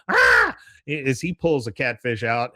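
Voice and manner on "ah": high loud hillbilly whoop; excited yell. Retold or imitated. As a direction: let out a whoop. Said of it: "Con go".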